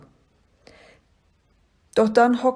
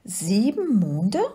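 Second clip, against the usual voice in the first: slow, wondering little creature; leises Staunen; slower than usual. A narrator's reading. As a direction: draw out the question in wonder. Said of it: "fragte es leise". (langsam und staunend gefragt)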